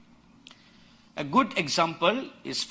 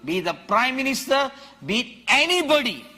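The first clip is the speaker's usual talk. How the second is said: much higher, louder shouted preaching; full-force preaching; 7 semitones higher, 5 dB louder than usual. Acted. Preacher rousing the congregation about a wild ox, shouting. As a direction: thunder it at the congregation.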